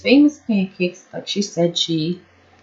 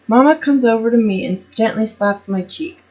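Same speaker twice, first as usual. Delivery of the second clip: ordinary delivery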